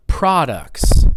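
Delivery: slow PROD-ucts, strong start; first-syllable stress. Shown as a demonstration. Teacher models a sentence for students, PROD-ucts stressed up front. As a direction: hit PROD in "products". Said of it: "product is on the first syllable".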